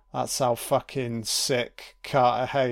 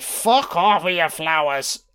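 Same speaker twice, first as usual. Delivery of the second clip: a put-on voice for a rough, fed-up brush-off; mock Drake telling him where to shove it; angry Drake; 4 semitones higher, 4 dB louder than usual. Viewer voicing Drake rejecting Carter's flowers, put-on gruff brush-off. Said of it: "Drake's like".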